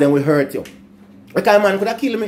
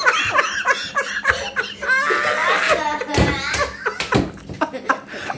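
high helpless laughter; 4 dB quieter than usual. Real, not acted. Long laugh at own story.

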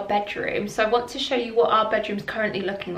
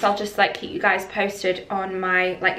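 ordinary delivery

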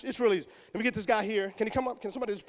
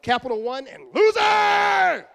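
higher, loud, slow gloating "loser!"; mocking shout; 7 semitones higher, 8 dB louder, slower than usual. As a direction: crow it in his father's face. Son mocking father.

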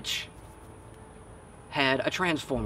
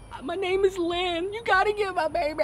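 panicked mom. Retold or imitated. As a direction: still frantic, giving her name through the panic. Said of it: "she was in shock, spazzing the fuck out".

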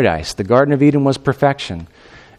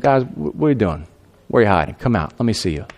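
gentle God; 5 semitones lower, 5 dB quieter than usual. Retold or imitated. God calls gently to them.

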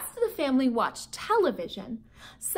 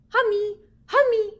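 put-on high, louder little-boy call of Hummy; little toddler calling; 8 semitones higher, 5 dB louder than usual. Toddler calls to the hamster, high and loud. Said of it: "a little voice called out".

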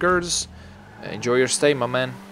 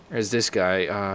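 ordinary talk

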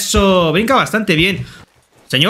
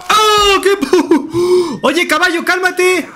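startled yelling; 10 semitones higher, much slower than usual. Real, not acted. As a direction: caught off guard, laughing. Horse goes wild. (grito alto de sorpresa al caballo)

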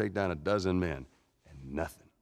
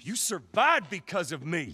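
shouting it at his brother; bitter, raised accusation; angry outburst at his brother; 9 semitones higher than usual. Acted. Brothers' heated argument.

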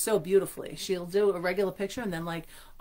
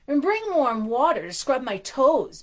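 bellowing; 7 semitones higher, 9 dB louder, slower than usual. Acted. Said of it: "Bossy bellowed".

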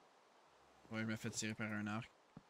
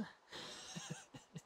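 high breathy giggle; 11 dB quieter than usual. Real, not acted. Laughing after hard fight.

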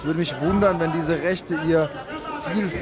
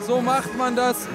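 excited commentary; 7 semitones higher than usual. Real, not acted. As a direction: relive the knockout with delight. Co-commentator celebrates the knockout.

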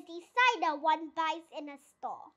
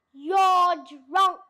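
blunt accusation; 8 dB louder than usual. A narrator's reading. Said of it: "said Mister Fox".

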